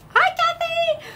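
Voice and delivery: high, bright hello; excited greeting. Real, not acted. Host welcoming a viewer for a reading, high and excited. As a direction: call out to her with delight.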